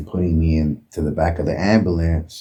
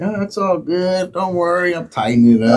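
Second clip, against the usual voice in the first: put-on reassuring kids; 12 semitones higher than usual. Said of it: "I was like".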